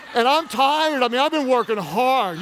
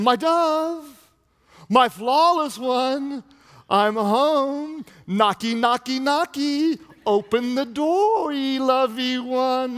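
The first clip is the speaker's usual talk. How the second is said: higher, drawn-out playful wooing; amorous husband; 3 semitones higher, slower than usual. Acted. Husband home late wooing his wife, playful and drawn-out. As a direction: sweet-talk her through the door, stretching the words. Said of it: "he's coming in saying".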